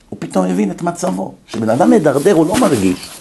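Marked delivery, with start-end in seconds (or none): none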